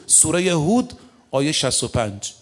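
ordinary talk

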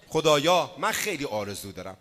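ordinary talk

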